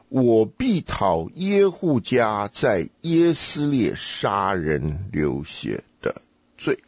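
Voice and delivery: measured, word-by-word scripture reading; slow careful reading. Shown as a demonstration. Teacher has student hunt for the key word, slow deliberate reading. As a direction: read very slowly, phrase by phrase. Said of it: "我们要是慢慢读的话".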